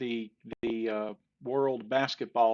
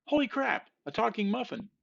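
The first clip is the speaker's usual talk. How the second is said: high-pitched shocked cry, louder at the start; startled muffin; 12 semitones higher, 3 dB louder than usual. Acted. Muffin shocked that the other muffin talks, high startled yelp. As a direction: exclaim it way up high in mock astonishment.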